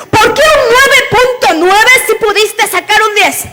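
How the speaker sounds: a high, pressing parent's complaint; demanding perfectionist parent. Retold or imitated. Preacher mimics a perfectionist parent scolding a grade, high and pressing. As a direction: play the never-satisfied parent, pitched high. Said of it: "Hay papás perfeccionistas que dicen".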